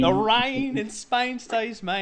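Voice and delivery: slow, high mock Cockney, hammed up; Eliza Doolittle Cockney. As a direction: ham up a bad Cockney flower-girl accent, stretching it out. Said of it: "they sounded like Eliza Doolittle".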